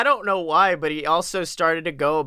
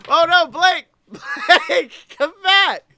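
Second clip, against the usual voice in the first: much higher, louder mock panic; mock alarm; 12 semitones higher, 6 dB louder than usual. Pretending his co-host froze, hammed-up alarm. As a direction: call after him in hammed-up alarm. Pretends Blake got hurt.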